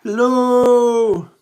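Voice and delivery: loud, high call for help; stuck Tigger calling out for help, loud and high; shout. Acted. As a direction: shout so someone far away hears. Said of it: "Then he began to shout".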